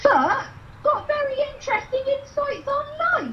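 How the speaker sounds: high, breathy gossip voice; dotty wig character. Acted. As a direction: play a giddy eccentric lady. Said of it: "this one here, it's a lovely person".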